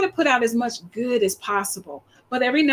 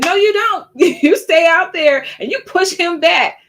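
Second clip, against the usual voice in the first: loud, raised-pitch motherly order; stern mom; 6 semitones higher, 6 dB louder than usual. Acted. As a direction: lay down the law like a mother. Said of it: "and my mom said".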